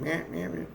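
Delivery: high, light mock crow calls; imitated bird chirping. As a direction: mimic a pestering bird. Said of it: "the bird keeps chirping".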